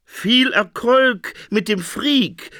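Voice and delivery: high, slow, mock-cheerful; well-wishing interpreter. A narrator's reading. Interpreter sends Phoenix off, chirpy. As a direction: wish him luck sweetly. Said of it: "wünschte der Traumdeuter".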